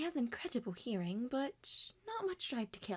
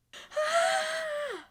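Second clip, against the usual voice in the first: long high gasp of horror; shocked gasp; 6 dB louder than usual. Acted. Pomni learning Gangle is dead, sharp horrified gasp. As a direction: gasp as the news lands. Reaction to another death.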